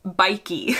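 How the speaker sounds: "bikey?" said as a question, NY-kee ending in a long ee; rhymes with bikey. Shown as a demonstration. Host asks viewers to say it rhyming with bikey. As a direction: end "bikey?" on a long ee sound. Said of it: "rhyming with bike or rhyming with".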